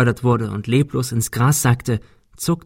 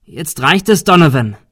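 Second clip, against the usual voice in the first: higher, loud confrontation; firm shout; 5 semitones higher, 7 dB louder than usual. A narrator's reading. Someone confronts the man in the fog, firm shout. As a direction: call him out sharply by name.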